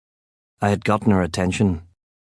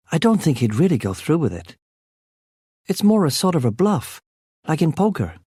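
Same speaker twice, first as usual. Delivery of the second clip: Kit's voice; 7 semitones higher than usual. A narrator's reading. Kit voiced, higher than narrator.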